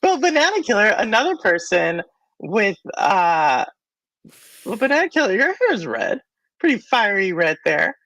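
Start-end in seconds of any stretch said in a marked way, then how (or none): none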